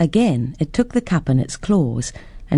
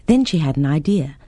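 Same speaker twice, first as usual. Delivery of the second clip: ordinary delivery, nothing marked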